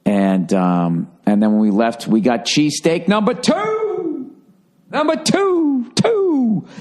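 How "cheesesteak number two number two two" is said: excited. Real, not acted. Excited about second cheesesteak.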